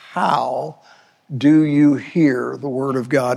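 how set apart, then a pause; stress on how. Shown as a demonstration. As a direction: isolate how before finishing the question. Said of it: "I place an emphasis on that word".

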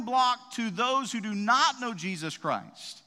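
full-force preaching. Acted. Pastor rousing the congregation about God's armor, fervent preaching. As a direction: lift the room, preach it hard.

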